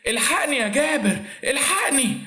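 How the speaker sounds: high, tearful call for her husband; a fake panicked plea; mock cry for help. A narrator's reading. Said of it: "صرخت وهي بتنادي عليه". (استغاثة مصطنعة باكية)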